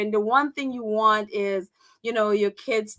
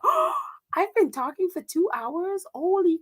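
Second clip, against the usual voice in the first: gasp, then a high startled "oh"; sudden realization; 6 semitones higher than usual. Real, not acted. Realizing to viewers she's talked two hours, startled gasp. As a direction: catch yourself suddenly, gasping.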